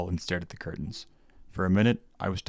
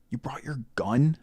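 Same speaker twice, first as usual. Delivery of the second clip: shocked question; 7 semitones higher, slower than usual. A narrator's reading. Shocked at seeing gun.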